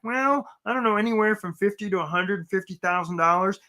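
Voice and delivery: vague, wishy-washy put-on voice; a clueless job seeker shrugging off the question; unsure job seeker voice. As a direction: play a job seeker who has no idea what they want. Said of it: "my voice I use for the job seeker that doesn't really know".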